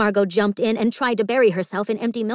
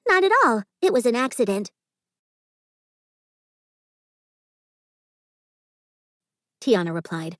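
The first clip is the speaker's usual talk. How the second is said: kind, higher friendly voice; reassuring; 4 semitones higher than usual. Acted. Tiana kneeling beside Margot, gently reassuring. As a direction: put her at ease.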